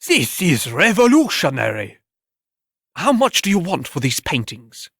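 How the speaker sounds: high, breathless critic gushing over the work; awed art critic. Acted. Critic excited by paintings.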